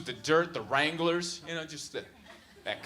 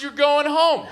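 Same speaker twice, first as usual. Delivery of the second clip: high, louder flat imitation of the driver; mock driver; 9 semitones higher, 6 dB louder, slower than usual. Comic mimicking the Uber driver's only phrase. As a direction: parrot his one English phrase. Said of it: "the only English he knows is".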